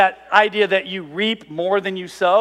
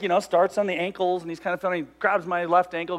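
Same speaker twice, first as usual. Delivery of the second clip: ordinary talk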